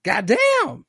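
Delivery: thrilled exclamation at a badass line; hype reaction. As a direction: hype it up like a great movie moment. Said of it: "And I was like".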